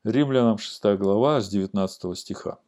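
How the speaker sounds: usual speaking voice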